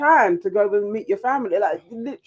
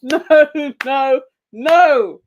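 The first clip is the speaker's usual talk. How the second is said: high, loud laughing protest; cracking up while saying no; real helpless laughter; 7 semitones higher, 5 dB louder, much slower than usual. Real, not acted. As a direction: burst out laughing, then protest through it. Cracking up at a viewer comment.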